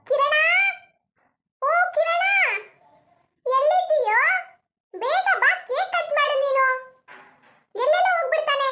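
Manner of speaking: high, loud call across the house for her son; mother-in-law shouting for her son Kiran. Acted. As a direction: holler for the boy, half annoyed. Mother-in-law calling for missing son.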